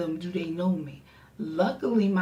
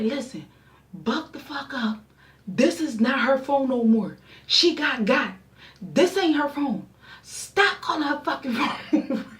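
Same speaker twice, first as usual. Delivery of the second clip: high, loud, cussing phone rant; angry higher voice breaking into a laugh; loud telling-off; 6 semitones higher, 3 dB louder than usual. Retold or imitated. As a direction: tell him off till you crack up. Friend cussing out the caller.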